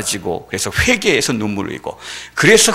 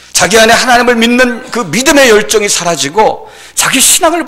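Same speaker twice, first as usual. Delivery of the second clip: high, loud sermon delivery; impassioned preaching; 10 semitones higher, 8 dB louder than usual. Acted. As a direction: press each phrase out forcefully. Preaching at full force. (열정적으로 외치는 설교)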